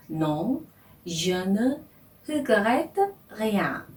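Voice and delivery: slow French pronunciation "Non, je ne regrette rien"; French title. Shown as a demonstration. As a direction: read the French title slowly and carefully. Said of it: "이렇게 되어 있죠". (또박또박 천천히 읽는 프랑스어 제목)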